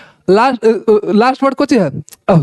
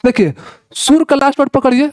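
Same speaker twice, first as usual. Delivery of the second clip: normal talking voice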